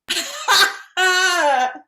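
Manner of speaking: loud burst of laughter; big laugh. Real, not acted. Host cracking up at the scene, loud laughing.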